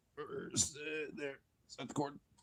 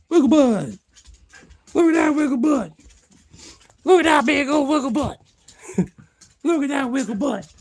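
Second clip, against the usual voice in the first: excited pet talk; 13 semitones higher, 7 dB louder than usual. Real, not acted. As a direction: squeal with delight at the dog. Cheering on a wiggling dog.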